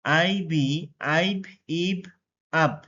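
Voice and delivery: teacher's drill contrasting the vowels in আইব, ইব, আব; combined pronunciation drill. Shown as a demonstration. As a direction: spell আইবি, then give আইব, ইব, আব as separate readings: eye, ee, ah. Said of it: "সেগুলো একসাথে উচ্চারণ করব".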